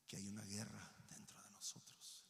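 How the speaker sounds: whisper. Acted. Whispered warning.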